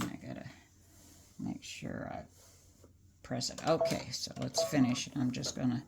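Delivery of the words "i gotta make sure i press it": muttering. Real, not acted. Muttering while pressing the shirt.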